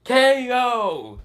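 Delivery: high-pitched mock announcer call; knockout announcer. Calling Kiba's knockout by chair, high announcer shout.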